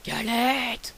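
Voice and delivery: lightly voiced wolf, slow menace; a hint of a hungry wolf; wolf threatening the galette, lightly voiced. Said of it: "dit le loup".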